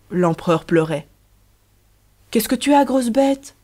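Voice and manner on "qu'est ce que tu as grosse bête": higher-pitched startled cry to a loved one; exclamation étonnée. A narrator's reading. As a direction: exclaim it, voice jumping up in surprise. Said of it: "s'écria Riquette". (exclamation étonnée, voix plus aiguë)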